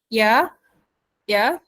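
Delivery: ordinary delivery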